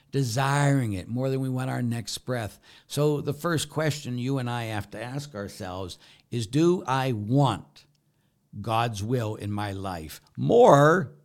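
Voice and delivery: sermon climax on free will; full-force preaching. Acted. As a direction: preach it from the pulpit.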